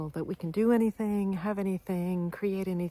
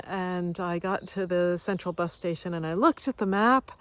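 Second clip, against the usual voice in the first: normal talking voice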